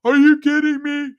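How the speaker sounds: high squealing mock girl voice; teasing Tess with a squeaky whine. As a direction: shriek it high like a whiny friend. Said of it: "She was like".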